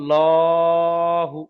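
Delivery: লাহু held very long, with a heavy, rounded ল; heavy l demo. Shown as a demonstration. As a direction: stretch লাহু out slowly and thickly. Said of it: "লাম খাড়া দেওয়া". (লাহু খুব টেনে, পুর ল উচ্চারণে)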